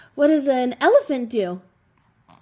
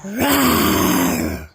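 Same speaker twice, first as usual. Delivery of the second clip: a lower, growly pretend roar for the toddler; playful low rawr; 5 semitones lower than usual. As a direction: rawr like a friendly toy lion, a bit lower. Playing animals with toddler.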